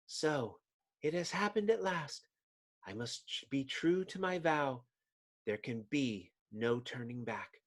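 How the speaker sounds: soft, hushed determined voice; quiet resolve. Acted. Susan at tea, softly resolving to act. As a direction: murmur a sacred vow.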